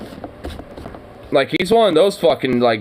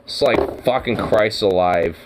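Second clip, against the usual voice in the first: normal talking voice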